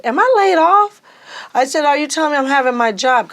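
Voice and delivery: high, loud shocked question; shocked disbelief. Retold or imitated. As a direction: blurt it out in disbelief. Learning of layoff.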